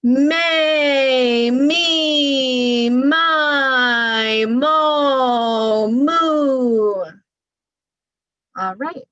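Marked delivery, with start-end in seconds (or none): 0.0-7.1 loud, deliberate, linked syllables; strong projected voice drill with the vowels kept joined. Shown as a demonstration. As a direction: send each syllable out to the far tree. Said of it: "Here we go".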